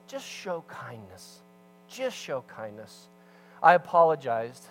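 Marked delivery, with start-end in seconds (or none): 0.1-3.1 soft, slow and low, like a calm voice; gentle slow call. Acted. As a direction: speak kindness into the room softly.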